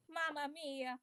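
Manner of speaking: a lightly sung-out happy cry; playfully pleased, kept small. Acted. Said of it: "say happily".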